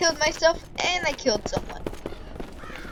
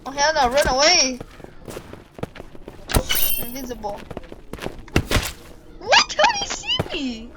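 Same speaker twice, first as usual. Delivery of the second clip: panicking gamer. Real, not acted. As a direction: run away in a panic. Fleeing enemy in game.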